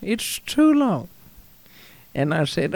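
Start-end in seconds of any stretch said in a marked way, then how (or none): 0.0-1.1 high mock-French objection, drawn out at the end; French accent. Wife objects to husband's hair length, French accent. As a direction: put on a high French wife's voice. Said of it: "do it in a French accent".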